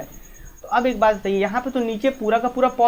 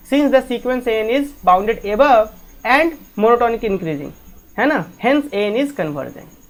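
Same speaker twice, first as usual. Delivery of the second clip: slow dictation; much slower than usual. Shown as a demonstration. Teacher dictating the proof line.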